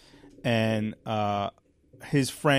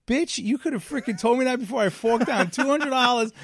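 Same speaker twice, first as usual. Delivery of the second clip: higher, indignant retold rant; mock outrage; 9 semitones higher than usual. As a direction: vent the outrage, pitched up. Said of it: "I'm like".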